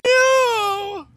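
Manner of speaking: high despairing cry; anguished shout. Real, not acted. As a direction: wail in dismay. Unit nearly dead.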